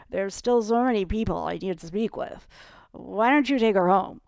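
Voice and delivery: put-on loud, drunken role voice; drunken husband. A narrator's reading. Drunk husband pushing her off.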